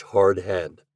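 ordinary delivery, nothing marked

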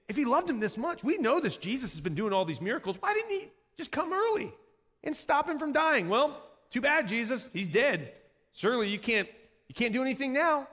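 high, incredulous complaint; sceptical jeering. Acted. Onlookers challenging Jesus over Lazarus dying, sceptical jeering.

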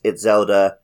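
usual speaking voice

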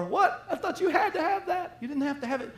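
high, laughing mock scolding; mock teasing. Mocking the child's abandoned toy.

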